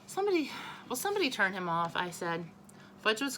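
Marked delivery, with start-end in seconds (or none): 0.0-1.9 fast, high exasperated plea. A narrator's reading. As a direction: beg someone to stop him, fed up. Exasperated brother.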